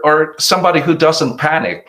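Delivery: ordinary talk, nothing marked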